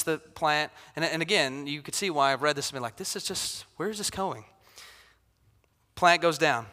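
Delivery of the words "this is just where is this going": near-silent whispered bafflement; puzzled whisper. Retold or imitated. Preacher recalls first reading Jonah, whispering puzzled. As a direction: breathe it out like a private confused aside.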